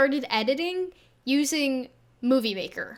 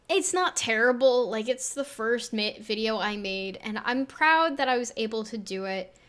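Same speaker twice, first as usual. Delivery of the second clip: ordinary delivery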